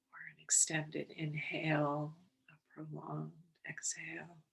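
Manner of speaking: unhurried, calm and measured, settling them in; guided meditation. Real, not acted. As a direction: guide listeners gently into the breath, leaving space between phrases.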